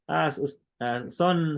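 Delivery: usual speaking voice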